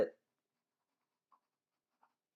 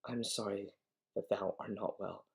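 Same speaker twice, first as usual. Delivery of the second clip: softer, coaxing apology; soothing sweet-talk; 7 dB quieter than usual. Acted. Juliet apologising to her unwell nurse, soft coaxing. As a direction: placate gently, repeating the endearment tenderly.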